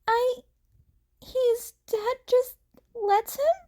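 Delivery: high, startled disbelief; can't believe it; surprised question. A narrator's reading. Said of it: "Izuku asked in surprise".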